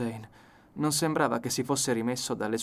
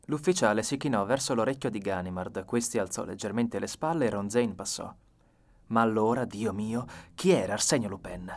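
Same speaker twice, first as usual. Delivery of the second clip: usual speaking voice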